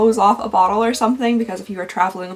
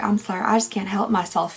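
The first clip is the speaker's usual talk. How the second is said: usual speaking voice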